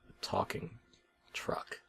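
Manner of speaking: a secret aside, almost breathless; hushed aside. A narrator's reading. Narrator marvelling at a talking truck, in a hushed whisper.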